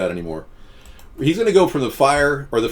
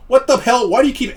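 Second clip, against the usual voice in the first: high, louder incredulous outburst; exasperated what the hell; 11 semitones higher, 4 dB louder than usual. Real, not acted. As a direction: react to a silly repeated question. Annoyed at repeated questions.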